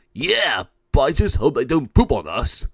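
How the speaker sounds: loud gargoyle voice; gargoyle worry. Acted. Gargoyle fretting about the birds, loud. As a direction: gripe like a grumpy little monster.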